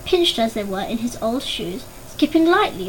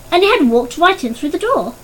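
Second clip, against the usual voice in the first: ordinary delivery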